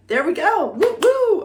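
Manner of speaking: high, loud celebratory woo woo; excited whoops. Real, not acted. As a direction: cheer the happy ending. Celebrating end of reading.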